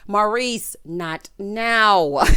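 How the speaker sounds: loud, drawn-out comic scolding; exasperated rebuke. Acted. Telling Maurice off during an arrest, loud exasperation. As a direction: cut him off loudly, on the edge of laughter.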